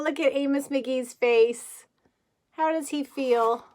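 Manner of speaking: usual speaking voice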